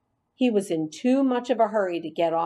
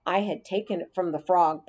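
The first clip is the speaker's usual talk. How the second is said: plain reading voice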